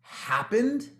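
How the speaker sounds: drawn-out, slowed bewildered question; bewildered what happened. Retold or imitated. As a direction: stretch it out, dazed and lost. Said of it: "like, go".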